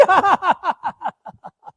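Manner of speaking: very high falling laugh. A narrator's reading. Wife's sister laughs at her answer, high and falling. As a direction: laugh with delight.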